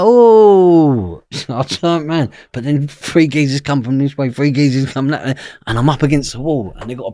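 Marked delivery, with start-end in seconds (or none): 0.0-1.2 high loud mock "oh"; startled. Spotting men running at him outside the dentist, a loud startled oh. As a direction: caught off guard, ham the shock.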